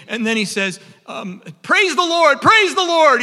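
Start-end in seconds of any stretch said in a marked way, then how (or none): 1.6-3.2 much higher, louder jubilant praise; retold cry of praise, loud and high; joyful shout. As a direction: shout it out in triumph. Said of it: "He's, he's shouting in his journal".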